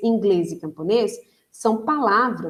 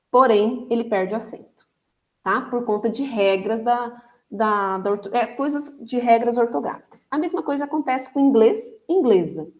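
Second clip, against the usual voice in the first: ordinary talk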